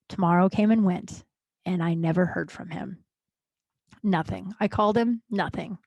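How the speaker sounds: ordinary talk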